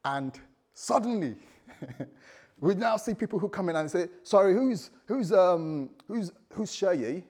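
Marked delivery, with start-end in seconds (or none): 4.2-7.2 louder, higher, slow mock official; hammed-up collector asking for someone; pushy tax collector. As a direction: tax collector at the church door demanding a name.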